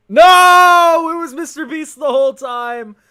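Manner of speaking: high, loud, hammed-up shout of betrayal; loud, high mock outrage. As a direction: scream it like a betrayal. Reacting to Mister Beast reveal.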